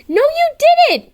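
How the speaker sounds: high, loud snap of a denial; sharp denial. Acted. Kim snapping back at Kel in an argument, high and loud.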